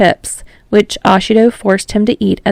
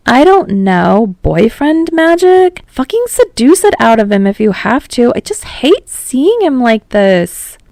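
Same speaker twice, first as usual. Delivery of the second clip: lightly voiced Ashido; 5 semitones higher than usual. A narrator's reading. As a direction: lift the pitch a little, half joking. Ashido voiced higher by narrator.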